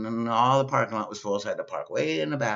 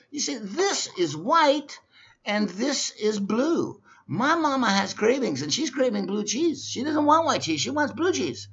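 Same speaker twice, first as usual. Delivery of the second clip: exasperated explaining; 12 semitones higher than usual. Retold or imitated.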